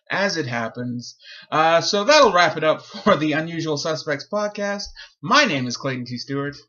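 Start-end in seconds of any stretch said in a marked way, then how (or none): 1.5-6.6 louder, measured sign-off read; podcast host voice. Acted. Host naming himself to listeners, announcer voice. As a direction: close the show like a radio announcer. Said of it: "Here comes the voice".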